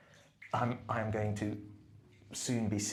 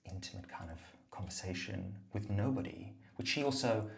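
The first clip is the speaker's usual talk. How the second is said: usual speaking voice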